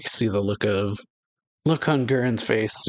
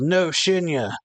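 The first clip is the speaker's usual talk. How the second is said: lightly voiced cry, pitched higher; mild yell; 6 semitones higher, slower than usual. Gurren crying out to Shinya, mild yell. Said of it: "Gurren yells".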